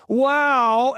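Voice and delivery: loud, high theatrical exclamation; Michael Levine. Retold or imitated. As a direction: proclaim it in a hammed-up imitation. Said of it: "And he's so theatrical about it".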